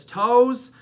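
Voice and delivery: loud, high punched "Toes"; mimicked preacher's call-out. Youth pastor quoting a speaker to the group, loud mimicked call-out. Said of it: "Manny Rangel says".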